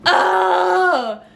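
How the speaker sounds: high, drawn-out groan of frustration; exasperated cry. Real, not acted. Livid viewer venting at the movie, exasperated cry. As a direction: let out a long high cry.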